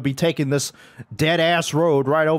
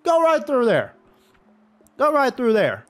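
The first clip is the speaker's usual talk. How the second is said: high, insistent yelling; exasperated command; 9 semitones higher, 4 dB louder than usual. Real, not acted. Gamer ordering cars through, exasperated yelling. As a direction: urge them through in a high yell.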